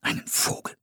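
soft whispered echo; whispering. A narrator's reading. Settlers echoing an odd idiom, whispering. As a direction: repeat it in a hushed whisper. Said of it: "wiederholten die anderen flüsternd".

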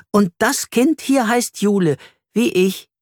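proudly name the dog after herself; little girl, pleased. A narrator's reading. Jule matches Flori's game, eager little girl.

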